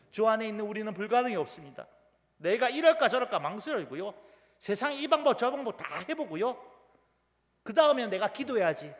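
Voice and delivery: high, loud pulpit exhortation; forceful preaching. Acted. Preacher warning believers not to pray last, forceful. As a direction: build with insistence phrase by phrase.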